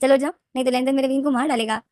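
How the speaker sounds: hammed-up begging to wake up; mock urgent plea. Narrator begs sleeping Leni to wake up, hammed-up urgent call.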